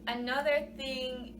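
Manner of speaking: usual speaking voice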